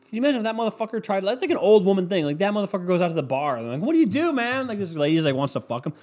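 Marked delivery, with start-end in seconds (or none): 3.8-4.7 high-pitched imitated questioner; mock bar small talk. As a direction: ask it high, playing the curious stranger. Acts out bar chat-up question.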